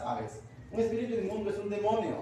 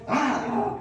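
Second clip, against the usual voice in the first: loud, high demon shriek with an unnatural tone; second take of the demon scream; demon cry repeated; 4 semitones higher, 6 dB louder than usual. Acted. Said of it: "A ver, repetimos".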